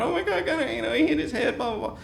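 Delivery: very high, frantic mock panic; imitating freaking out. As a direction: squeak it out like a panicked kid. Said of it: "she's freaking out".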